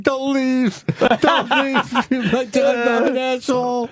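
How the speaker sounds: high, put-on crying plea; mock tearful pleading. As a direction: beg like a fake weeper. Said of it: "you're never gonna cry appropriately".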